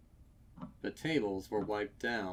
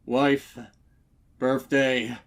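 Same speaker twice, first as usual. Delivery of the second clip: panting words between gasps, loud and high; breathless stallion blurting between heaves; out of breath; 4 semitones higher, 14 dB louder than usual. A narrator's reading. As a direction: gasp it out after a hard gallop. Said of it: "chest heaving with every ragged breath".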